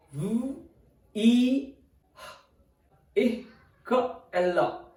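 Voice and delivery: "V, E, H, I, K, and L" as separate sounds. Shown as a demonstration. Teacher breaking down American pronunciation, sounded out. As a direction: sound out "V, E, H, I, K, and L" with gaps. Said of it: "American pronunciation is".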